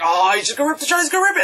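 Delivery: rising to high-pitched anticipation; excited moviegoer, voice climbing; re-enacted excitement. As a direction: build from plain to giddy and high. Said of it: "And I was like".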